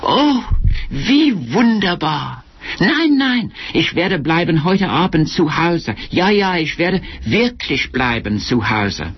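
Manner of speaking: high, swooping lover's telephone voice in German; performed, fluting sweet talk; theatrical cooing. A narrator's reading. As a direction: gush extravagantly to a darling on the phone. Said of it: "watching her like a performance at the theatre".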